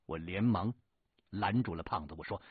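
usual speaking voice

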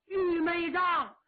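anguished cry. Acted. Eighth Prince shocked at execution ground.

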